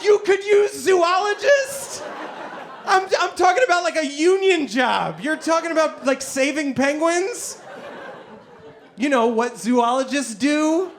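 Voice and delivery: hammed-up ribbing; mock incredulous. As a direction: scoff at the zoologist line. Mocking friend's job claim.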